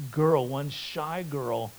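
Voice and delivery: ordinary delivery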